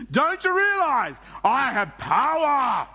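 slow, high, loud boast of power; arrogant threat. Acted. Pilate threatening Jesus, slow, loud and high. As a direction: lord it over the prisoner. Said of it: "That felt good, didn't it?".

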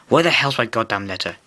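ordinary delivery

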